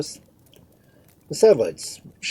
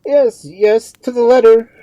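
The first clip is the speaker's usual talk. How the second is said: high, very loud concession; agitated priest's shout; bitter retort; 16 dB louder than usual. A narrator's reading. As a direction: fire it back in a raised, high voice.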